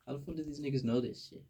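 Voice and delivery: ordinary talk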